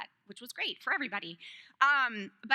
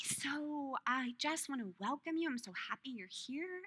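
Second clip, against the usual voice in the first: soft teacher welcome; 3 semitones higher, 7 dB quieter than usual. Retold or imitated. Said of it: "she's like".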